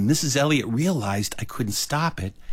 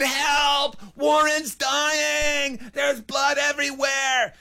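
high, stretched-out shout; panicked cry for help; much slower than usual. Retold or imitated. Boy to teachers for dying classmate, panicked shout. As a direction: wail the alarm at a shut door.

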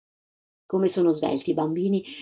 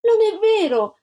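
put-on high, loud child's protest; shouted little-girl outburst; Antonella shouting; 12 semitones higher, 5 dB louder than usual. A narrator's reading. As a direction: cry out in indignant denial. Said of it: "gridò Antonella".

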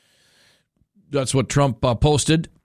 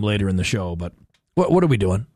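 ordinary talk, nothing marked

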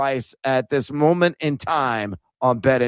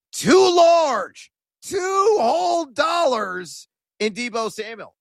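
mock grandiose; 9 semitones higher, slower than usual. Real, not acted. Joking bet size.

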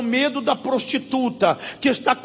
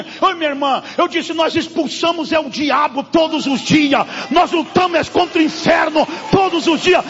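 loud, high, shouted rebuke; full-force preacher's cry; fiery preaching; 5 semitones higher, 4 dB louder than usual. Acted. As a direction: thunder at the congregation over their fear.

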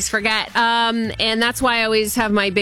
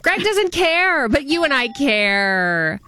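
slow, drawn-out, Greg doesn't care said much higher; emphatic repeat; 5 semitones higher, much slower than usual. Real, not acted. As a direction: lean hard on doesn't, then slow down for you and I.

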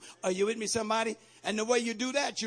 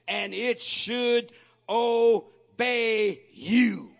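slow declaiming; 3 semitones higher, 9 dB louder, much slower than usual. Acted.

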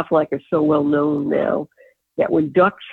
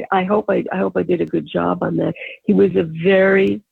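ordinary delivery

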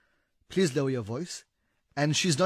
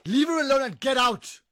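higher and louder, protective; commanding shout; 8 semitones higher, 4 dB louder than usual. Acted. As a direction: order him out. Boyfriend orders husband out.

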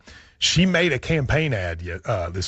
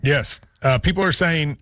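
ordinary talk

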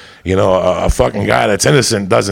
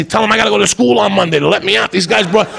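frantic pleading; 7 semitones higher than usual. Retold or imitated.

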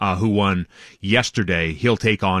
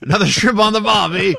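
higher, louder hammed-up Australian voice; bad Australian accent; 11 semitones higher, 4 dB louder than usual. Sports host joining the Melbourne joke in a bad Australian accent. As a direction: ham up a clumsy Aussie cliché. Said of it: "What accent was that? I apologize".

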